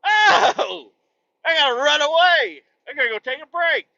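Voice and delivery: high, loud panicked squeal; hammed-up terrified shriek; mock panic. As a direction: flee the imagined snake in a shrieking panic. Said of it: "I'm like".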